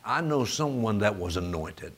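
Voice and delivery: low, soft, hesitant churchgoer; hushed, low imitation of a sceptic; imitated doubter. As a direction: mimic a doubting brother, quiet and low. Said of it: "you might start thinking".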